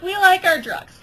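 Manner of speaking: proudly insist, a bit loud; defiant ducks. Acted. Ducks defending their drug habit.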